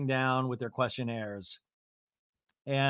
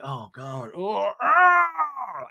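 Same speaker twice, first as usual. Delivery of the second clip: mock startled cry then loud yelp; startled shout; 4 semitones higher, 4 dB louder, much slower than usual. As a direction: jump in fright, yelping. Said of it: "I just fart".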